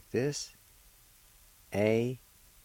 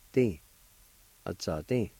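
usual speaking voice

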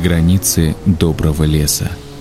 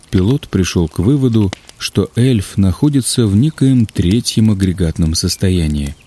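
ordinary delivery

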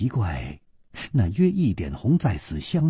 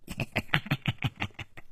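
soft, breathless staccato cackle; long, hushed villain's snicker; laughing at own joke; 4 semitones higher, 11 dB quieter than usual. A narrator's reading. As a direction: cackle quietly, pleased with your joke. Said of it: "他自己已经大笑".

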